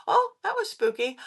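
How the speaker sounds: high-pitched mock eerie remark; spooked reaction. Reacting to the spirit camera, high and mock-spooked. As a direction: lift it high, lightly spooked.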